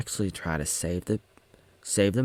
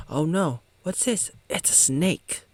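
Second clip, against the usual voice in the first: mock alarm; 8 semitones higher than usual. Mock voiced character spotting snake.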